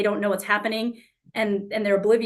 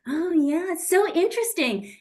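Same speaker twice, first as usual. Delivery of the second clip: high, sing-song fake politeness; fake interest; 6 semitones higher than usual. Smiling and nodding through their stories, fake interest. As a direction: pretend to care while fuming inside. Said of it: "And I get fake".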